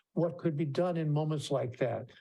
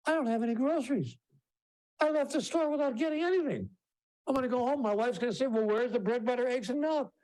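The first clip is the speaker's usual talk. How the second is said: mock panic; 9 semitones higher than usual. Sudden panic over forgotten groceries.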